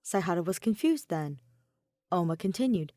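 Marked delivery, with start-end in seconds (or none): none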